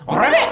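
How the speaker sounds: very high squeaky ribbit; frog ribbit. Pretend. Showing kids the frog sound, squeaky ribbit. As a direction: croak like a tiny frog. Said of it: "he makes a sound like this".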